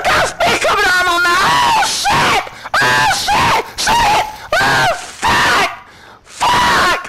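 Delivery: panicked high shouting; screaming at monsters in panic; scared horror game streamer. Real, not acted. Monsters attacking, door open.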